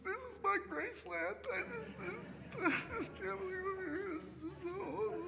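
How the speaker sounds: high, soft, tearful awe; choked-up crying. Retold or imitated. Fan finally inside his dream stadium, weeping softly. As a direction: voice breaking with overwhelmed joy. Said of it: "I was like".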